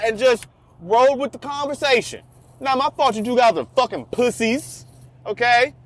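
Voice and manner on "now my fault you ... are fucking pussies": usual speaking voice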